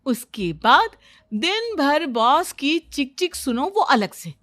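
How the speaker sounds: animated, emphatic boasting; swooping, self-assured storybook character voice; know-it-all daughter-in-law. Acted. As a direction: lecture them like an expert on everything. Daughter-in-law boasts about knowing everything.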